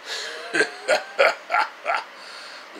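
breathy evil chuckle; villain laugh. Retold or imitated. Villain sneering at an intruder, breathy evil chuckle. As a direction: laugh low and menacing.

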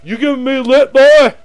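high, very loud threat; bullying cop shout. Acted. Cop accusing a suspect of giving lip, furious mock shout. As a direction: explode at the suspect, loudest on the last word. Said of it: "one of those big prick cops".

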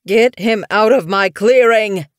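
put-on loud, furious old cat shout; angry shout. A narrator's reading. Medicine cat shouts at the kit, loud and furious. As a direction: bellow the demand. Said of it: "Goosefeather repeated".

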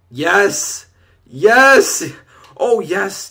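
loud, high cries of celebration; triumphant shouting. Real, not acted. Gamer celebrating an uncovered completion, triumphant shouting. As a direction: shout it in triumph, the second one biggest.

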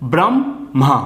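ব্রাহ্মা stretched into "bram... mha", rising then dropping; syllable-by-syllable pronunciation. Shown as a demonstration. Teacher demonstrating the same rule, ব্রাহ্মা split as "bram-mha". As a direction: break ব্রাহ্মা after a long "bram". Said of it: "এখানেও এরকম উচ্চারণ হবে".

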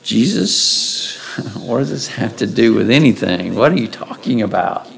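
a mock-confused disciple's aside, quiet and amused; puzzled apostle. Preacher voicing a confused apostle questioning Jesus, softly bemused. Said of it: "you may ask the question".

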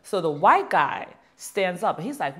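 ordinary talk, nothing marked

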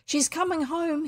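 high excited call; a guest bursting in with news; excited shout. A narrator's reading. Guest shouting to the others, excited. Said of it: "he shouted excitedly".

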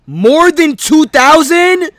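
high, slow, loud outraged gasp of a question; shocked disbelief. Acted. Gamer shocked at the number, shouting in disbelief. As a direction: drag it out in mock horror.

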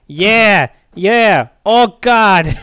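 loud high moaning.

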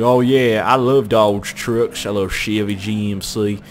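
put-on drawled, slow country Canadian accent. Imitating cousin's new boyfriend, country Canadian accent. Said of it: "he's country Canadian".

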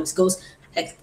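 ordinary delivery

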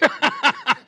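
short high chuckle; real laugh. Real, not acted. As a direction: let a laugh slip at the end. End of the story.

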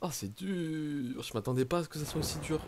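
drained after the ordeal; real worn-out sigh of defeat; deflated. Real, not acted. Exhausted after dying.

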